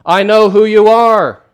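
loud, high, drawn-out challenge; demon asserting authority. Acted. Demon calling Jesus by name, loud challenge. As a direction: call it out loudly, claiming power. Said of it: "trying to exert authority over Jesus".

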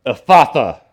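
sudden loud command; commanding word. Acted. Preacher voices Jesus healing the deaf man, loud command. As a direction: burst out with the single word. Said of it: "he speaks and says".

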